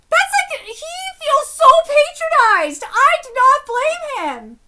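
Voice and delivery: impassioned fan rant; worked-up shouting. Real, not acted. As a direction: yell in heated sympathy, stretching the words. Worked-up reaction defending character.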